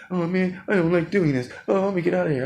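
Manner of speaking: high, whiny imitation of complaining guys; mock whining recruits. Others complaining in boot camp.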